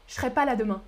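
je serai run together as ch'rai; rapid casual speech. Shown as a demonstration. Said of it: "Je parle rapidement". (« je serai » vite, prononcé ch'rai)

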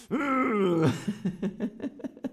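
high amused giggle; laugh. Real, not acted. Teacher laughs at her own acted anger. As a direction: breaks into a giggle.

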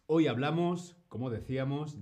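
ordinary talk, nothing marked

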